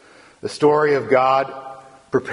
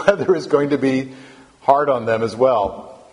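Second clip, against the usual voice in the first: ordinary delivery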